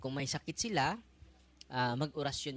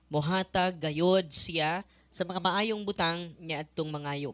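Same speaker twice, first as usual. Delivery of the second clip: loud, measured proclamation of the Gospel; gospel reading; 3 semitones higher, 8 dB louder than usual. Acted. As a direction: proclaim it from the ambo. (malakas na pagbasa ng Ebanghelyo)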